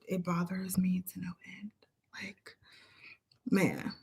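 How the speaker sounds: soft whispered aside. Real, not acted. Fed-up speaker trails off, whispered. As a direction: drop to a whisper.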